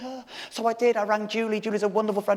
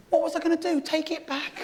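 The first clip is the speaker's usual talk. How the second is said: ordinary talk, nothing marked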